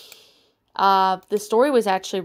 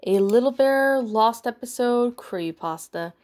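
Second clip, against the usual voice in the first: ordinary delivery, nothing marked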